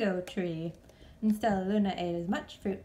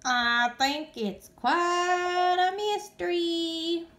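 high, slow sing-song chirp; 10 semitones higher, 6 dB louder, much slower than usual. Acted. Flap chirping about the mystery, sing-song. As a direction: chirp it like a bird. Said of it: "Flap chirped".

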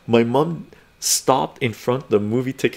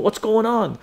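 mom's worried voice; 10 semitones higher than usual. Retold or imitated. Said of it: "She's going".

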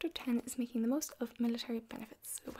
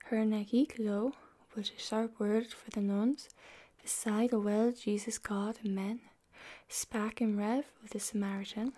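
whispered Middle English reading; library whisper; 6 dB louder than usual. Acted. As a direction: read the old verse in a hushed whisper. Reads Chaucer aloud in whisper.

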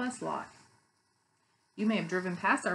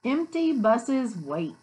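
loud, slow storybook reading; read-aloud to children; 6 dB louder, slower than usual. A narrator's reading. Teacher reads the bus book to children, slow read-aloud.

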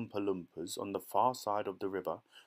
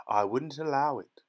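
loud, higher-pitched refusal; crying out; 4 semitones higher, 6 dB louder than usual. A narrator's reading. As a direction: exclaim it with firm indignation. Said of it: "cried Mister Wonka".

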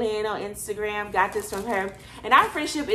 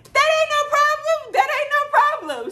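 very high, louder, sassy squeak; squeaky sassy yes; 5 dB louder than usual. Retold or imitated. Accepting the free dinner at his table, very high squeaky sassy voice. Playful quote after dinner offer.

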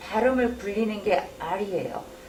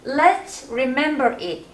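slow model read; 2 semitones higher, much slower than usual. Shown as a demonstration.

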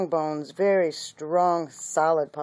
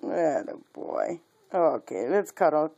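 ordinary delivery

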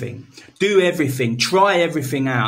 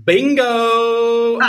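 a bright, louder, higher mock "Bingo!"; bingo shout; 8 semitones higher, 4 dB louder than usual. Friend calls out bingo as a joke, mock bingo shout. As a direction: call it out like you just won the card.

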